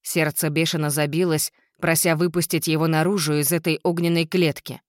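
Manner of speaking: plain reading voice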